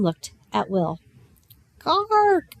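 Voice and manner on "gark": a gentle rook calling for attention; gentle rook caw. A narrator's reading. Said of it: "it said very gently for a rook".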